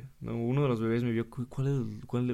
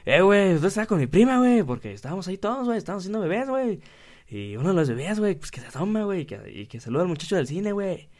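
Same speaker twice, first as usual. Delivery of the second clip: bad Monterrey accent; 6 semitones higher, 4 dB louder than usual. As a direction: ham up a regio guy telling the story. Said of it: "lo leí como un, como con man-, un mal acento".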